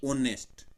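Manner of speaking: "ऑनेस्ट" with a silent h, punched; correct reading. Shown as a demonstration. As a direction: hit "ऑनेस्ट" from its opening vowel. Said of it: "एच इसके अंदर छुपा रहेगा". ("ऑनेस्ट" बिना ह के, स्वर से शुरू)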